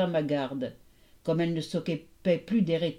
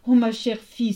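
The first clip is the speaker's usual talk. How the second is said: raised, loud joyful exclamation; emotional storybook cry; 4 semitones higher, 6 dB louder than usual. A narrator's reading. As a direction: greet a long-lost daughter. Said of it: "s'écria Déméter".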